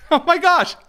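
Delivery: high, loud burst of laughter; laughing hard. Real, not acted. Host cracking up at congresswoman's clip, laughing loudly.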